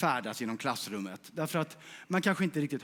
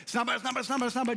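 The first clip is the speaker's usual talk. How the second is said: urging on; 8 semitones higher than usual. Real, not acted. Urging the whisper chain faster.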